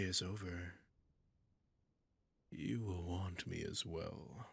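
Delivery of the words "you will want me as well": ordinary talk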